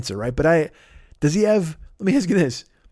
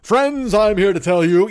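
loud, stentorian radio host, pitched up; Limbaugh; 6 semitones higher, 4 dB louder, slower than usual. Retold or imitated. Mimicking the host's lost old radio voice, stentorian. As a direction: boom it out like a talk-radio orator. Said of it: "stentorian, the bass, the, you know, whatever".

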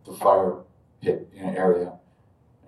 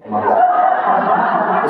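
very slow, breathy moved sigh; tender exclamation; 9 semitones higher, 7 dB louder, much slower than usual. Retold or imitated. Puja leader reaches over to the weeping narrator, slow tender sigh. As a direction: melt at the sight of him. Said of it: "reaches over like this and goes".

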